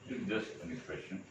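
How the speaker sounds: normal talking voice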